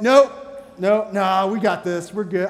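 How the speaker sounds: loud, high, cocky refusal of help; prideful brush-off. Retold or imitated. Youth pastor refusing the mechanic's help, loud and proud. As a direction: wave off the mechanic, too proud to admit trouble. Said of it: "And in my pride, I'm like".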